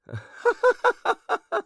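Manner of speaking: high, loud shrieking laugh; high laughing fit. A narrator's reading. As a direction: let the lady burst out laughing. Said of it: "rolling from side to side with laughter".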